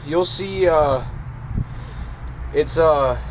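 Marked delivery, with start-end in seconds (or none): none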